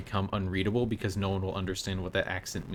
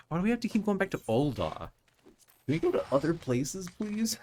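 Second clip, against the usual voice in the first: high, whining complaint; whiny plea; 9 semitones higher than usual. Real, not acted. Gamer whining to the group about Ul'dah, whiny. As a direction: beg to go somewhere else, pitched way up.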